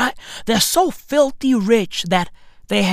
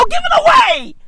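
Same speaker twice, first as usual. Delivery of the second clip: top-of-the-lungs yell, pitched way up; furious shout. Real, not acted. Yelling at the heiress to give her money away, furious. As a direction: bellow it at her.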